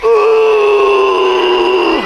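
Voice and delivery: long, high strained "uuh" of anger; angry growl demo. Shown as a demonstration. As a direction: act out boiling anger in one held sound. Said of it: "Rasanya marah-marah kayak gini". (geraman marah, panjang dan tinggi)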